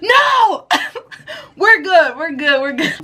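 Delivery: high, giggling mock reassurance; laughing denial. Sisters covering to dad, high laughing denial. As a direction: brush it off through laughter. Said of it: "me and my sister were like".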